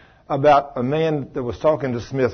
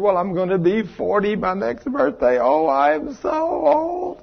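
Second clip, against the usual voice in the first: mock whining woman; 5 semitones higher, 3 dB quieter than usual. Preacher mocks women fretting about forty, high whining. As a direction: whine it like she's ancient. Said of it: "especially women".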